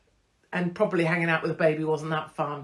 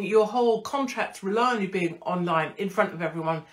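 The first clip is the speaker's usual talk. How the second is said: normal talking voice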